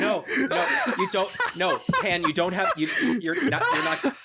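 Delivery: high-pitched, breaking up with laughter; laughing protest. Real, not acted. Correcting Pan's impression, laughing too hard. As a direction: object helplessly through giggles. Cracking up while correcting friend.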